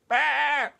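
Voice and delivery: high, loud frightened bleats; crying out. Acted. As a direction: bleat for help as loud as you can. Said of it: "And Oscar cried out".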